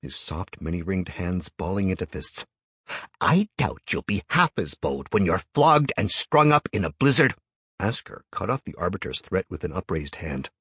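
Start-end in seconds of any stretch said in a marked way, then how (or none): 3.2-7.3 threatening. A narrator's reading. Enraged elder threatens.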